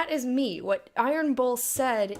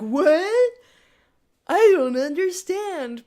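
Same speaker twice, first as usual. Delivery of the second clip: clueless player character; 8 semitones higher, 10 dB louder, much slower than usual. Retold or imitated. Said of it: "the player character being like".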